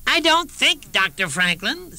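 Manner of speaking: high, superior scholar's voice; a smug scholar looking down on him; haughty Baconite. Retold or imitated. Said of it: "said this Baconite".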